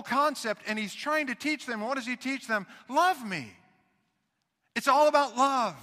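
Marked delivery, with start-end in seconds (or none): 4.7-5.8 louder, higher, driving it home; emphatic proclaim. Real, not acted. Preacher proclaims love to his congregation, emphatic. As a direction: proclaim the point to the church. Repeats the line as a contrast.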